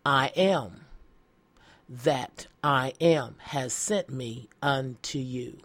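slow declaration, spaced phrase by phrase; solemn scripture reading. Acted. Pastor quoting what Moses must tell them, slow and solemn. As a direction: pronounce it slowly, with weight and pauses. Reads God's answer from scripture.

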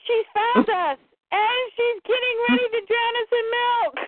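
high-pitched mock dread with a laugh creeping in; acted comic horror. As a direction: cry out in hammed-up alarm. Acted horror at monster cow.

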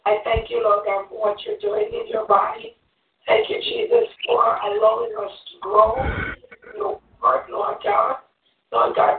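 fervent, earnest congregational prayer; closing prayer. Real, not acted. Woman closing the Bible study in prayer, earnest prayer. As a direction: pray it aloud, calling on God phrase by phrase.

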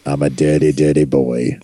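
low mock version of the co-host's catchphrase; imitating the co-host. Host mimicking the co-host's catchphrase, low imitation. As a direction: drop low to mimic an Australian friend. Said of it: "so much better when you do it".

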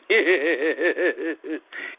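campy villain cackle; maniacal cackle. Acted. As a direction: fake maniacal laugh, hammed up. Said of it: "that's my little maniacal laugh".

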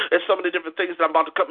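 normal talking voice

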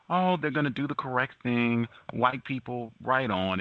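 high mocking voice of naive hope; mocking supporters. Mocking the hopeful supporters, in a high sarcastic voice.

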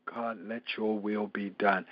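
very low, soft, slow prayer; hushed yielding to God; surrendered prayer. Acted. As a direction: pray it quietly, deep in the voice. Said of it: "What are you praying?".

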